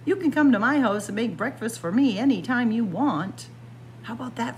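ordinary delivery, nothing marked

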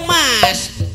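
normal talking voice

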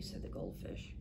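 usual speaking voice

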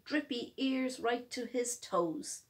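ordinary delivery, nothing marked

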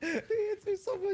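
very high mock whine; mock girlfriend whine. Player joining the girlfriend joke, squeaky whine. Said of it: "I sound like Poop's girlfriend".

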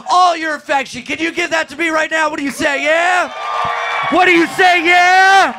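loud hype host voice to pump the crowd; rally the room loudly for the special; announcer hype. Acted. Hyping crowd for taping.

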